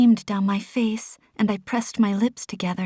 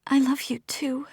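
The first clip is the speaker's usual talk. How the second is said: thick whisper; 5 semitones higher than usual. Acted. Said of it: "I whispered thickly".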